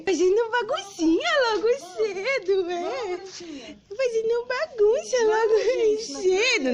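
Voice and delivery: high sing-song pet voice; pet talk. Acted. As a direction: coo at the pet playfully. Talking baby voice to a pet.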